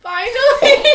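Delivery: high, loud laughing cheers; excited cheering. Real, not acted. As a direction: cheer with relief. Got the good flavor.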